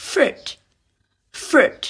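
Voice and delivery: "fruit" said alone, high falling pitch; model pronunciation. Shown as a demonstration. Teacher models "fruit" for students, model pronunciation. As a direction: pronounce "fruit" as a crisp word to repeat. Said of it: "ฝึกอ่านออกเสียงสามคำนี้".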